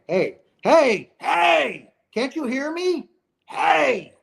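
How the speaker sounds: high, urgent calling out to be heard; retold screaming. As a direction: shout for someone who can't hear you. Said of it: "He's screaming".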